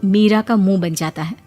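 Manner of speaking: ordinary delivery, nothing marked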